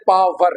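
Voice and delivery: पावर as "paa-var", with a clear v; local mispronunciation. Shown as a demonstration. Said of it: "हम क्या कहते हैं?". (पावर, देसी व के साथ)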